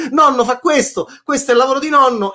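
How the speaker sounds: high, loud proud shout. Acted. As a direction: yell it out proudly. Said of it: "quando urlavo così".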